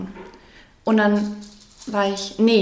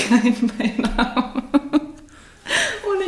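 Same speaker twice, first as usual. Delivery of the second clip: laughing; 5 semitones higher, much slower than usual. Real, not acted. Slow laughing retort.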